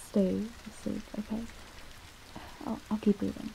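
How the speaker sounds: very soft, so as not to wake; hushed voice for a sleeper. Real, not acted. Listener has fallen asleep.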